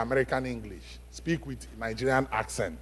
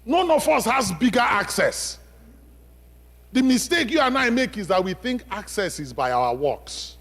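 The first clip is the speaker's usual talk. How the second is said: loud, high preaching that drives each point home; preaching at full force; 10 semitones higher, 5 dB louder than usual. Acted. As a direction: proclaim it to the whole church, raising the voice. Preacher raises voice on congregation.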